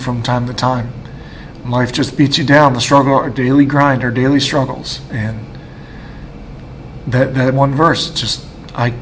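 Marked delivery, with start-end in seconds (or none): none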